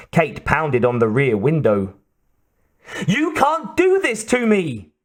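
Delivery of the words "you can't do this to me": high-pitched anguished cry; desperate protest. A narrator's reading. Captive pounding on the window.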